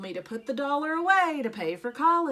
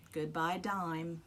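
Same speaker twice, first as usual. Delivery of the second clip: mock mournful; 6 semitones lower, 6 dB quieter, slower than usual. A narrator's reading. Mournful refrain over lost money.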